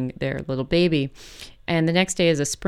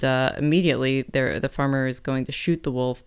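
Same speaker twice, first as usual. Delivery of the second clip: normal talking voice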